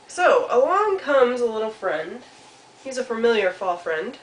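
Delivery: put-on storytelling to children. A narrator's reading.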